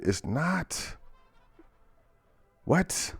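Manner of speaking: high, breathless what; shocked disbelief. Real, not acted. Reacting to a flipped food pyramid, shocked and high. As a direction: caught completely off guard.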